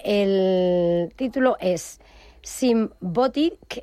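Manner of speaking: spells the name out slowly; slow, deliberate naming; slow and careful. Real, not acted.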